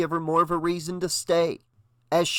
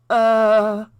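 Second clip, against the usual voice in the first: loud, high groan of disgust; drawn-out grossed-out groan; disgusted moan; 6 semitones higher, 7 dB louder than usual. A narrator's reading. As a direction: moan out your revulsion. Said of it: "Brenda moaned with disgust".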